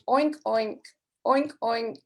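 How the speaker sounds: lightly voiced pig oinks. Teacher shows the English pig sound, lightly voiced. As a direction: grunt it out like a farm pig. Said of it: "It says".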